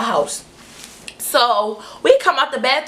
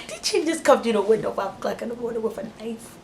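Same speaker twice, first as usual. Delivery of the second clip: hushed disbelief; 7 dB quieter than usual. Retold or imitated. Retold stunned thought while hiding.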